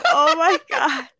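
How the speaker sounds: slow, high laughing exclaim; laughing oh my god. Retold or imitated. Retelling a perfect bite, laughing high and slow. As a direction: gasp it out through laughter. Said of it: "I was like".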